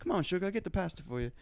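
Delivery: high, soft coaxing American auntie; American accent. Retold or imitated. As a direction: soothe in a put-on American accent. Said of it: "giving her an American accent".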